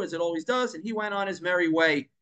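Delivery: ordinary delivery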